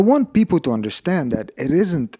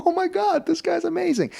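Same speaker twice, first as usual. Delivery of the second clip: high-pitched retold gushing; awed excitement. Actor thinks about the helpful stuntman, high retold excitement. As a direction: gush in a put-on, very high voice.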